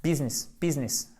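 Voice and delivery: "business" with a short first i; two-syllable English "business". Shown as a demonstration. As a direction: say "business" as BIZ-ness, short i. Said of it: "C'est un i court".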